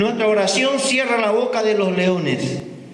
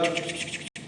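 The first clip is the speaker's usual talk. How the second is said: typing noise; 11 dB quieter than usual. Retold or imitated. Mimics typing at a computer.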